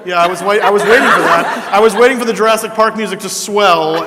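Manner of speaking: laughing. Real, not acted. Laughing at his own joke.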